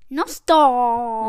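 playful loud call. Pretend. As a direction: boom it out, stretching the ending. Child playfully belts the Welsh phrase.

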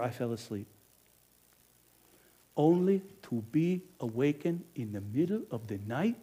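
ordinary talk

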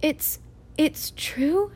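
soft, slow and halting question; barely daring to ask; hushed faltering disbelief. Acted.

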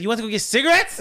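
high, loud retold disbelief; incredulous question. Narrator to drunk Fixo, incredulous. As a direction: repeat it back in acted disbelief.

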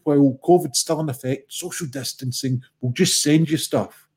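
usual speaking voice

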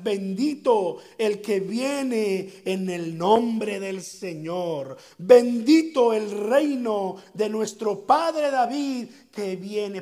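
slow, solemn, loud proclamation; stately chanted blessing; proclaiming acclamation. Acted. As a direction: proclaim it like a crowd hailing a king. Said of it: "lo acomodaban también con estas palabras".

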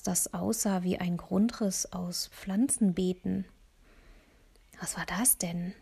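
ordinary delivery, nothing marked

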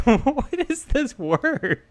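comic disbelief, high and drawn out; baffled. Retold or imitated. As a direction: squeal out your confusion at the strange word. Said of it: "I'm like".

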